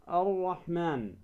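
"الرحمن" slowly as ar-Rahman, no L; lam assimilated. Shown as a demonstration. Tajwid teacher repeating the correct form, ar-Rahman for "الرحمن". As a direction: merge the L into a long R in "الرحمن". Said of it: "اللام الشمسية ندغمها".

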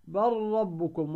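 بل ربكم slowly with the ل merged into a doubled rr; slow tajweed demo of the merged ل; correct assimilation. Shown as a demonstration. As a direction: say بل ربكم as bar-rabbukum. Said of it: "فتقول مثلًا".

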